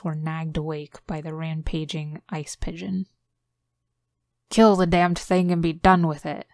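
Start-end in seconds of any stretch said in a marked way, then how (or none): 4.5-6.5 loud booming roar; baritone roar. Acted. Jurgen roaring at the crew over the penguin, loud roar. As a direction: bellow it over the din. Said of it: "a baritone roar".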